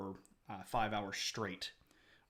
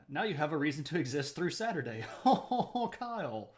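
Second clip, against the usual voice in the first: breaking into laughter while talking; higher, laughing as you read it out; amused laugh running into the words; 4 semitones higher, 5 dB louder than usual. Real, not acted. Laughs reading a chat comment.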